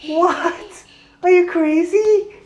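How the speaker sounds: high squeaky fake astonishment; mock shock. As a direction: act playfully shocked at her. Dad mock-shocked at toddler.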